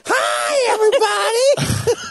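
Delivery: put-on high, slow old lady voice. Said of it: "when you do the old lady voice".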